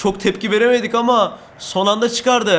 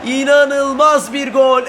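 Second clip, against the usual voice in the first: long drawn-out sung goal scream; screaming football commentator; 6 semitones higher, 7 dB louder, slower than usual. Acted. Goal scored, commentator style.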